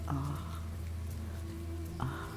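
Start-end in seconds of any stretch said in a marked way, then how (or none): none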